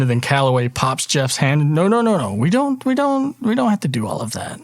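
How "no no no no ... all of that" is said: high, hurried nervous protest; flustered newcomer. Acted. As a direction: stammer it out high, waving it all away. Jeff brushes off the handshake incident.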